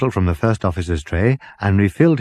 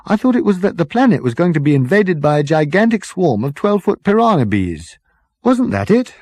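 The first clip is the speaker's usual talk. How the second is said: higher, keen officer voice; eager first officer; 10 semitones higher, 5 dB louder than usual. Acted. First officer offering his theory about the planet, eager. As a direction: chip in brightly.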